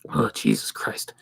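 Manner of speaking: a real whispered groan to himself; whispered aside. Real, not acted. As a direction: breathe it out under your breath. Muttered aside reacting to chat.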